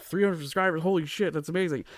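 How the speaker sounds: high, thrilled gush; excited podcaster. Retold or imitated. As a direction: gush like it's huge news. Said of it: "who are like".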